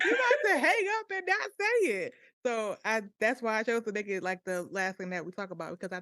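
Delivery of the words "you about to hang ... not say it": high laughing insistence; laughing explanation. Retold or imitated. Explaining to her brother why she yelled, laughing and high. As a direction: laugh it out, pitched up. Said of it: "And I'm like".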